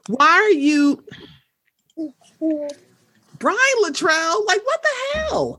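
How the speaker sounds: high, slow baffled question; baffled disbelief. Retold or imitated. To [name], high baffled disbelief. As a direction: ask it in stunned bafflement. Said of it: "I'm sitting here like".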